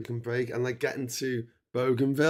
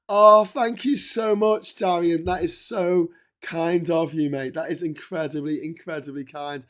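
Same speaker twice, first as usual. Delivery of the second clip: overwhelmed thanks; 6 semitones higher, 11 dB louder than usual. Real, not acted. Thanking a donor for money.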